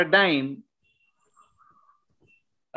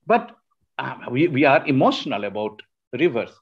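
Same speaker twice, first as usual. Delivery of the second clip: ordinary delivery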